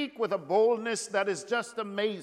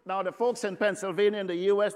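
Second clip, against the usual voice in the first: normal talking voice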